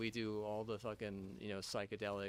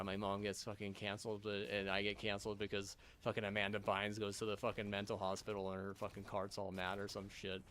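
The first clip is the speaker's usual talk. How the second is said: usual speaking voice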